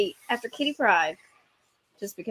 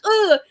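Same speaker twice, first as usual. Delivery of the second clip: higher loud grunt; raging grunt; 9 semitones higher, 6 dB louder than usual. Retold or imitated. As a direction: grunt mid-fight. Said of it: "he's like".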